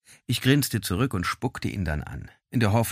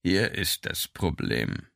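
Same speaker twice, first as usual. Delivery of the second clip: demon lord voice; 2 semitones lower, slower than usual. A narrator's reading. Alligor voiced in story.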